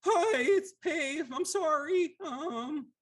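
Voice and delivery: very high, slow, tearful little sludge voice; timid Lemure. Acted. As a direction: squeak it out like a weepy blob creature. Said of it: "Lemure looks at you and goes".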